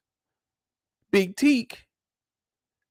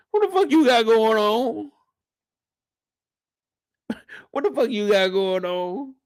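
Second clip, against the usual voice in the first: exasperated question; 3 semitones higher than usual. Retold or imitated. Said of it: "I said".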